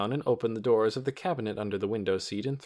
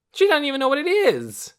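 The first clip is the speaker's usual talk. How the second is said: put-on bratty boy; 10 dB louder than usual. A narrator's reading. Child taunting, raised voice.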